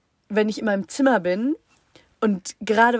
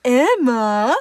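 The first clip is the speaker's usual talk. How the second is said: louder, higher sing-song call; mum's calling tone; 3 semitones higher, 6 dB louder, much slower than usual. Retold or imitated. Mum calling daughter, loud high sing-song call. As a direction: imitate mum's usual calling voice. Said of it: "immer in diesem einen Ton".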